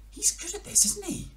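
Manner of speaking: very high silly dog voice; a squeaky impressed dog. Acted. Dog talks to cat about frog, very high.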